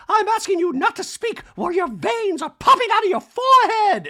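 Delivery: very high, very loud mock yell; hammed-up shrieked telling-off; Dorit yelling. Castmate scolding Kyle at the showdown, hammed-up yell. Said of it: "And Dorit yells".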